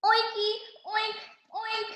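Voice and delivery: high playful oinking; piggy oinks. A narrator's reading. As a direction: oink like a happy little pig. Pigs greeting in pig talk.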